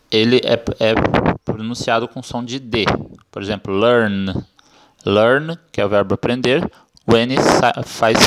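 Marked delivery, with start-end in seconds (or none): none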